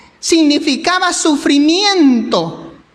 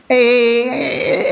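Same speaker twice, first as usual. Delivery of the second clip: ordinary delivery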